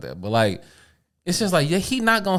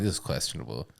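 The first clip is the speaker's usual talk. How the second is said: low, soft skeptical mutter; a muttered side comment of doubt; skeptical aside; 12 semitones lower, 12 dB quieter than usual. Retold or imitated. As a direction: say it under your breath, doubtful. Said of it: "I'm always like".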